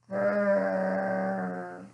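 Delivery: low drawn-out growl; bear growl. Acted. Mysterious sound over the windowsill, low bear growl. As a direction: rumble it deep from the belly. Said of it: "Grrr rumbled from the bear's belly".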